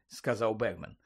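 usual speaking voice

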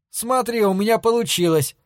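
high, bright with joy; thrilled at his success; delighted. Acted. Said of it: "обрадовался Пикеринг".

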